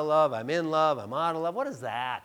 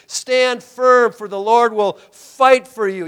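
commanding Moses; 2 semitones higher, 14 dB louder than usual. Retold or imitated. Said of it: "He says".

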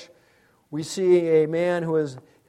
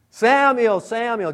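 calling out; 6 semitones higher, 6 dB louder, slower than usual. Acted. God calling the boy.

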